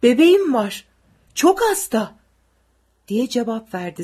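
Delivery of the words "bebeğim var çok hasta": urgent plea. A narrator's reading. Father pleads at gunpoint.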